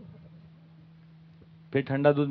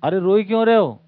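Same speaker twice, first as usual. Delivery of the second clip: put-on louder, impatient scolding of a crying child; 3 semitones higher, 8 dB louder than usual. Parent to whining child, mock stern scolding. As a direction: snap at the child to stop crying.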